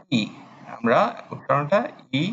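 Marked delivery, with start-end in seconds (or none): 0.1-0.2 ই pronounced like Bengali ই, short and clear; Japanese i sound. Shown as a demonstration. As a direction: say ই as a short, clean i vowel. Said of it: "আমার সাথে উচ্চারণ করুন ই".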